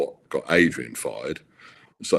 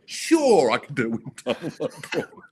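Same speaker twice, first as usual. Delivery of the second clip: breezy bravado; 9 semitones higher than usual. Retold or imitated. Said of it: "And I went".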